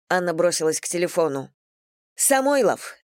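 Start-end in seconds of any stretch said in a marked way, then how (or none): 2.1-3.0 high mock yell of his name; yelling into phone. A narrator's reading. Anna yells at Igor down the phone, pitched high. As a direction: call him out, pitched high. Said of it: "заорала она в трубку".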